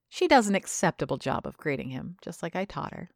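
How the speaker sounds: plain reading voice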